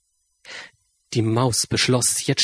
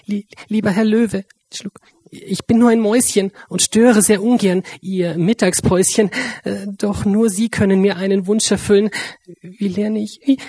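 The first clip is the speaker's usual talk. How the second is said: high, halting little mouse voice, stammering; timid mouse; 7 semitones higher than usual. A narrator's reading. Tiny mouse asking the sleeping lion for help: timid stammer. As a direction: squeak it out nervously, swallowing.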